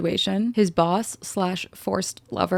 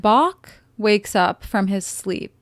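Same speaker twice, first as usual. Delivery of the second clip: ordinary delivery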